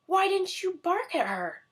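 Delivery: high, loud furious witch; angry shout. A narrator's reading. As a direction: scream at the dog. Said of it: "shouted Bony Legs".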